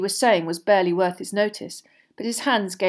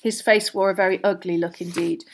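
plain reading voice

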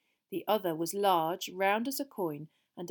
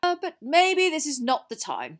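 high, loud second thought; Dumbledore checking himself; 8 semitones higher, 8 dB louder than usual. Acted. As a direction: break off the joke in a projected voice.

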